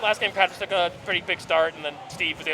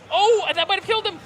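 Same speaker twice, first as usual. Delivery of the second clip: excited; 13 semitones higher, 6 dB louder than usual. Real, not acted. Caster reacts to near kill.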